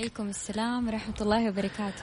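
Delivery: faint, distant off-mic voice; far from mic. Real, not acted. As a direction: answer from away from the mic. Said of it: "وشك على المايك".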